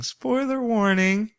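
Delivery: high, slow sing-song "Spoiler warning"; mock announcer spoiler warning. Imitating her catchphrase, high and slow. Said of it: "That's what she keeps saying".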